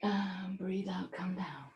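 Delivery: slow breathy whisper, almost silent. Real, not acted. Yoga teacher slowing the class's exhale, whispered slowly. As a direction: let the cue drift out on the breath.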